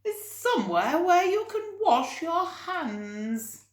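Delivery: hammed-up fussy lady; Mrs Armitage. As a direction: raise the voice into a prim old lady. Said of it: "said Missus Armitage to herself".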